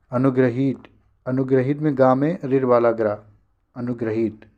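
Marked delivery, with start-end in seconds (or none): none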